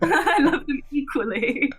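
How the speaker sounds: high-pitched playful motherly insistence, laughing; mock-earnest mum through giggles. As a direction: protest like a mum, cracking up. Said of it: "it sounds like you're their mum".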